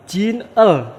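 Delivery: jin el said split apart, high and loud; separate syllables. Shown as a demonstration. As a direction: break it as jin, el. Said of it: "maka dibacanya sajinel".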